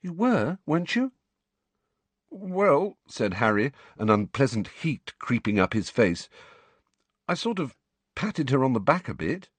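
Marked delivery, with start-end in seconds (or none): 0.0-1.1 high, worried checking question; anxious. A narrator's reading. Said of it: "looking up anxiously". 2.3-3.0 high, awkward hesitation; embarrassed. A narrator's reading. Harry embarrassed, heat in face. 7.3-9.5 high, halting, awkward admission; sheepish. A narrator's reading.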